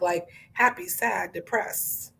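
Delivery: ordinary talk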